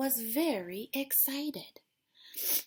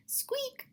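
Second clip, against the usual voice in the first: squeak. A narrator's reading. Lantern squeak imitated.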